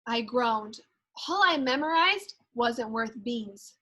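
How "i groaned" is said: ordinary delivery, nothing marked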